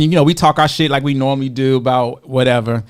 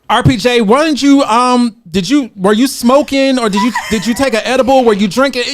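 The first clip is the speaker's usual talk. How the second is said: mock accusing; 8 semitones higher, 3 dB louder than usual. Teasingly accusing her husband.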